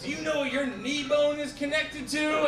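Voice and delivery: high, urgent mock teaser; teasing news anchor. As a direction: hook the viewer with a high-pitched question. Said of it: "hook you in by asking you questions".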